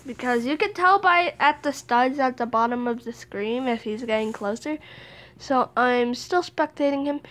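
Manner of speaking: usual speaking voice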